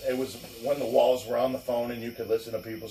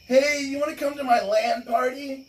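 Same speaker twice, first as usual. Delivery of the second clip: mock eager invite; 11 semitones higher than usual. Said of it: "he'd be like".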